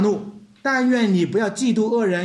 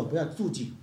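ordinary delivery

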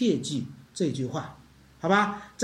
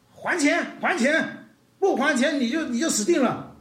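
higher, threatening demand for money; demanding payment; 6 semitones higher than usual. Acted. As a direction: throttle him as you shout for your money. Servant throttles the small debtor.

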